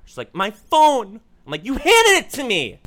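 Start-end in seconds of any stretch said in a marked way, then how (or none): 0.3-1.2 frantic date. Retold or imitated. Date frantic in her car. 1.6-2.8 high, loud exasperated cry; exasperated shout. Retold or imitated. Telling his date she already handed him the phone, high loud exasperation.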